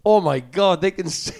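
amazed. Retold or imitated. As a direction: gasp it out in astonished delight. Realising bar singer can sing.

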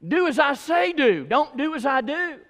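high, singsong parental saying; imitating a parent. Preacher mimics his parents' old saying, high-pitched parental refrain. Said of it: "and dad would tell me".

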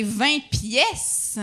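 very slow "pièces" with a clear è; correcting to pièces. Retold or imitated. French person correcting Quebecers' piastres, slow "pièces" with stressed è. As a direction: draw out "pièces" as a correction, open è. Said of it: "voyez-vous comment ils nous corrigent".

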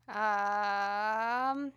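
ordinary talk, nothing marked

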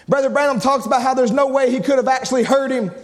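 usual speaking voice

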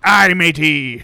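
a hint of a slow pirate. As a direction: drawl it like a pirate. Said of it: "a pirate slave owner".

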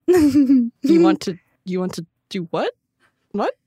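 loud, very slow disbelief; mock indignant. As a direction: overplay shocked disbelief. Mock offended friend.

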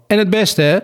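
high, offhand farewell call. A narrator's reading. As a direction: toss out a parting wish, pitched high. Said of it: "riep Lange Wapper". (hoog nagroetend)

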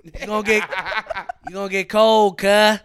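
laughing tease. Real, not acted.

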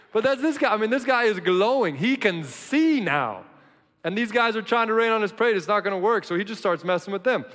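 loud, high, fired-up preaching; full-force preaching. Acted. Pastor to congregation, about the healed blind man, fired-up preaching. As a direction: shout the joy of the healed man.

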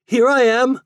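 high loud call. A narrator's reading. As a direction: shout to be found. Soldier calling out.

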